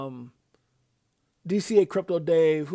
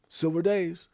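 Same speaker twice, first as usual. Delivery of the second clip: usual speaking voice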